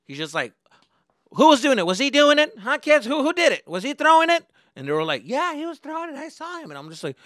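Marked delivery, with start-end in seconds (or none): 1.3-4.4 loud, high-pitched questioning; angry interrogation. Retold or imitated. Bus driver questions the kids. 5.2-6.7 put-on high-pitched tattletale kid; tattling nerds.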